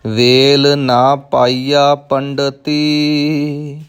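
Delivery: slow, measured recitation with drawn-out syllables; scripture recitation. Shown as a demonstration. Teacher models a scripture line for learners, slow recitation. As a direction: recite each word slowly and evenly.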